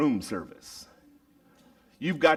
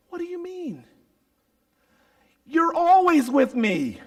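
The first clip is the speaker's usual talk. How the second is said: tender reassurance; 6 dB louder than usual. Acted. Father comforting the resentful son, tender. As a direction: reassure him with love.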